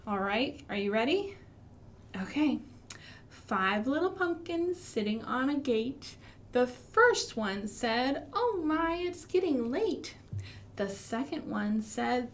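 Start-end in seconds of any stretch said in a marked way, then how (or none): 3.3-12.3 rhythmic storytime chant; finger-play rhyme. Pretend. Teacher reciting a finger-play rhyme to children, rhythmic. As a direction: recite it like a nursery rhyme for toddlers.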